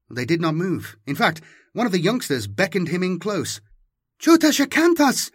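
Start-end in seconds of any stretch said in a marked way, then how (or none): 4.2-5.3 very high, loud eager call; excited shout. Acted. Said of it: "she shouted".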